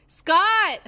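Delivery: high, loud shout; shouting name. Acted. Ava shouting for Scott toward the dining room, loud high shout.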